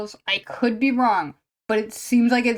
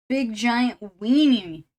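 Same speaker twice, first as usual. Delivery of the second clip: drawn-out weenie; 6 dB louder, much slower than usual. Real, not acted. Jokey self-insult, drawn out.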